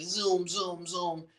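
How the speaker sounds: high, soft car-zoom sound effect; playful zooming imitation. Retelling a rushed drive in Medina, playful zooming. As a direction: zip the words out like a toy car.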